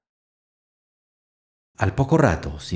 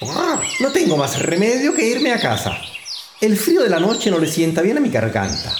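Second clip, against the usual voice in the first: higher, lightly voiced old magpie; a fussy old bird muttering excuses, pitched up; 6 semitones higher than usual. An old magpie mutters an excuse to leave, high fussy voice. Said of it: "una vieja urraca ... murmuraba".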